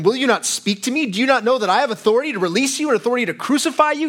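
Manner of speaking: a governor losing patience with his silent prisoner, agitated; agitated Pilate. Retold or imitated. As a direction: press Jesus with rising impatience. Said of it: "He's getting agitated".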